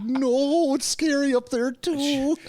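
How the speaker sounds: put-on high, whiny scaredy-kid voice; mock scared kid. Teasing friend voicing a scared teen, mock whine. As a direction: whimper it like a frightened boy, half joking. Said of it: "I'm like".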